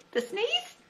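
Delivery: high, small questioning voice; a curious little pig checking; puzzled Piglet. A narrator's reading. Piglet asking Pooh about the sneeze.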